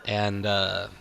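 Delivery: normal talking voice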